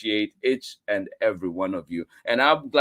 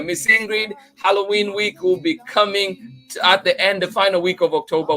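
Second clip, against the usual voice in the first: higher and louder, eager news; excited announcement; 7 semitones higher, 5 dB louder than usual. Real, not acted. Host telling a viewer about Halloween week, excited. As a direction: share the news with a rush of excitement.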